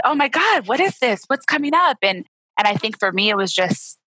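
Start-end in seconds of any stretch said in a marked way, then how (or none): 0.0-2.0 higher, bewildered "what is this"; retold alarmed surprise. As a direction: replay the shock of tears out of nowhere. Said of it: "And then I said".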